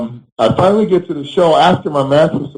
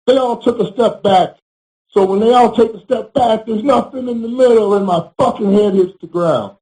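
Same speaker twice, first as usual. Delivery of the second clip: higher, worked-up rant about the fall; indignant storyteller, pitched up and fired up; heated retelling; 6 semitones higher than usual. Real, not acted. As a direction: let the outrage climb as you relive it. Angry retelling of injury.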